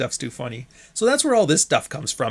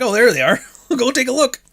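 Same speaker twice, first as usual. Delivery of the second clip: mocking high quote; 11 semitones higher, 5 dB louder than usual. Mimicking her pointing people out.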